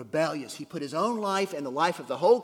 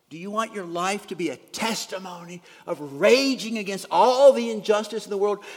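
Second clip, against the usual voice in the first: louder and higher, building to a cry; rising preaching; 5 semitones higher, 9 dB louder than usual. Real, not acted. Preacher rousing the congregation against a life of rage, loud. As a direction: build to full preaching force.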